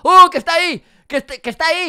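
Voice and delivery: very loud, high cry of alarm; startled shout. Real, not acted. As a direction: yell in fright at a sudden sighting. El monstruo aparece fuera. (grito agudo de susto)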